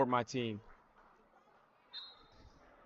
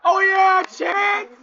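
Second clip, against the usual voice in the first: screaming for his little brother, way up high; all-out fan yell, not a commentator; cheering yell; 12 dB louder, slower than usual. Real, not acted. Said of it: "My bad, I'm yelling".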